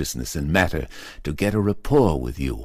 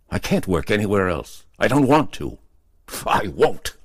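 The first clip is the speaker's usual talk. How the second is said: higher and louder, building to defiant refusal; rising anger; 5 semitones higher, 5 dB louder than usual. Acted. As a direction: dig in, angrier with every line. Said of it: "Carson snapped in a sudden blaze of anger".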